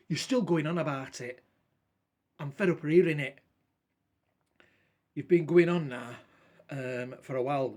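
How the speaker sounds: bursting out at him after hours of it; retold outburst. Snapping at the droning friend.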